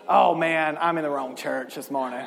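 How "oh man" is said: ordinary talk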